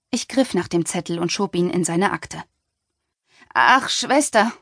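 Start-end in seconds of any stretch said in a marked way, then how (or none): 3.5-4.5 slow, higher call of a condescending man. A narrator's reading. The TV chef calls out to the departing doctor, patronizing and slow. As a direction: call her back, drawing out the word. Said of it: "rief er aus".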